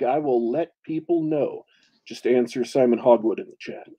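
usual speaking voice

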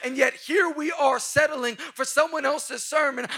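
loud, driving pulpit voice; full-throated sermon build; preaching at full force. Acted. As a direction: hammer it home to the crowd.

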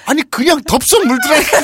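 high, loud protest breaking toward laughter; hammed-up eyewitness exaggeration; overacted insistence. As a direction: insist excitedly, over the top. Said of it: "막 더 오바를 하는 경향이 있어요".

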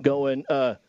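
ordinary talk

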